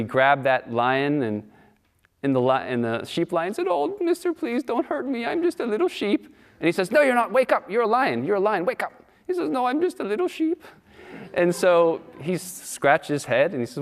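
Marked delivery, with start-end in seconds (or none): 3.7-6.2 high, small pleading voice; timid little sheep. Acted. 6.9-8.9 high, firm urging lion voice; commanding lion. Acted. 9.5-10.6 high, small pleading voice; timid little sheep. Acted. Sheep lion refuses again.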